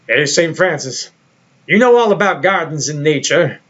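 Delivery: a hint of Morgan Freeman's measured God voice. God chatting with Saint Francis about lawns, in a lightly imitated Morgan Freeman voice. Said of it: "I will again attempt to do Morgan Freeman".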